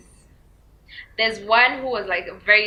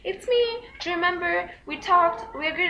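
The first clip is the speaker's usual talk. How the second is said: mock familiar driver; 9 semitones higher than usual. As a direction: drag out the cosy greeting. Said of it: "He's like".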